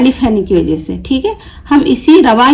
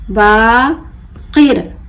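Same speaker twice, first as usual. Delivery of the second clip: बाकिर slowly split, long बा, pause, किर; broken into syllables; much slower than usual. Shown as a demonstration. Teacher breaking the word for learners, बा... किर with a gap. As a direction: pull Baqir apart into बा and किर. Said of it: "तोड़ तोड़ कर पढ़ूंगी".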